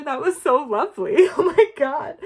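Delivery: laughing happily. Real, not acted. Reader signs off to pile two, laughing with delight.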